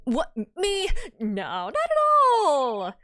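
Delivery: hammed-up innocence; mock innocent protest. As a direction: act far too normal. Naruhodo denies acting strangely.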